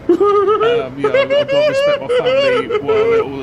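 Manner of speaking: loud, high and laughing; laughing shout. Real, not acted. Laughing while shouting thanks.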